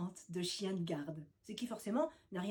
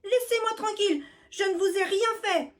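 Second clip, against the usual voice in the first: high loud plea; desperate cry; 10 semitones higher, 8 dB louder than usual. Acted. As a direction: cry out to be left alone. Mouse pleading loudly.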